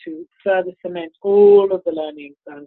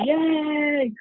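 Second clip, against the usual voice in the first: mock cheer; 9 semitones higher than usual. Congratulating himself on bestseller.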